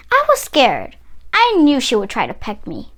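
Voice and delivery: ordinary delivery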